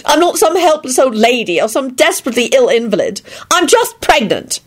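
high-pitched, indignant woman; acted emotional self-defence; upset protest. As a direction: protest in a worked-up, plaintive voice. Pregnant wife protesting tearfully.

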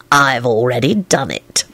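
low, slow snake-like menace; serpentine hiss. A narrator's reading. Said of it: "hissed in a positively serpentine tone".

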